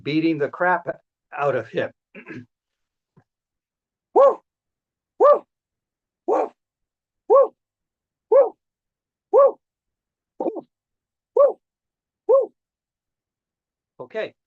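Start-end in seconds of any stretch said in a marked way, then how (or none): 4.1-12.5 loud whooping cries. Acted. Cat fight impression sounds.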